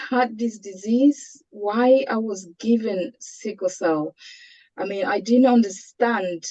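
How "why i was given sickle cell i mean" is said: ordinary talk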